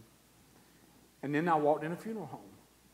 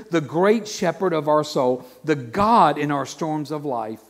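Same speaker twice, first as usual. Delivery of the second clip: loud, higher, proclaiming each line; full-force preaching; 4 semitones higher, 11 dB louder than usual. Acted. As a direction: preach it out to the church with fire. Preaching God's presence.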